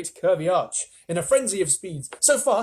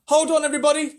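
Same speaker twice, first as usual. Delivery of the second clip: loud, higher warning call; 12 semitones higher, 8 dB louder, faster than usual. A narrator's reading. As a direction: shout to everyone to brace. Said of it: "James called out".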